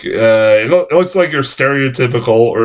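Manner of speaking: ordinary talk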